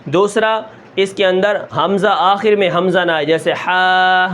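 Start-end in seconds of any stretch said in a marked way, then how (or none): 3.2-4.3 slow ہا trailing into a breathy h; غلط: ہا کے آخر میں ہا; wrong ha ending. Shown as a demonstration. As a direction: demonstrate the mistake: ہا with an extra breath. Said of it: "یہ ہا آ گیا".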